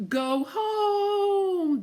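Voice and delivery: very high, sliding wind call; high eerie yodel of the wind. Acted. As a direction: yodel it like a gale. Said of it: "the wind yodeled".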